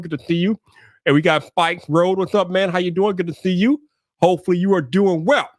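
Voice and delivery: normal talking voice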